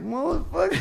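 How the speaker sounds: the penny drops, voice jumps way up; mock-shocked high-pitched swear; stunned realization. Realizing the promoter cheated him.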